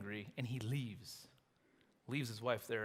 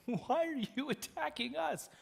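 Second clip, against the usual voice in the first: high, whining question; mock whiny protest; 12 semitones higher than usual. Men of Judah whining at the Philistines, pitched high. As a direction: play the wronged bystanders.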